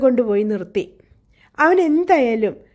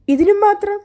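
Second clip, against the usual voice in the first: incredulous protest; 9 semitones higher, 4 dB louder than usual. Acted. Catherine protesting to her brother Hindley, shocked and high. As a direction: pitch leaps high, louder, disbelieving.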